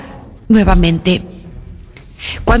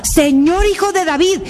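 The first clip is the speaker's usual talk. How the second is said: acted plea of a tormented mother; retold desperate cry; 6 semitones higher than usual. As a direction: cry out to God, pitched high without extra volume. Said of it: "a medio templo ... gritaba".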